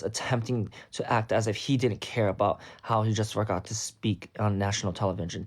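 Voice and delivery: usual speaking voice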